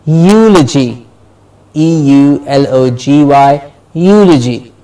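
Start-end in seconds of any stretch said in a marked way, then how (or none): 0.1-1.1 "Eulogy" as YOO-luh-jee; stress before -logy. Shown as a demonstration. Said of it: "the syllable before logy is stressed". 3.9-4.7 "Eulogy" as YOO-luh-jee; stress before -logy. Shown as a demonstration. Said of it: "the syllable before logy is stressed".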